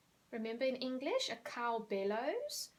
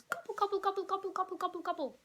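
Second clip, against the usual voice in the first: high, loud gobbling imitation; turkey gobble; 8 semitones higher, 5 dB louder, faster than usual. Shown as a demonstration. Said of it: "like that".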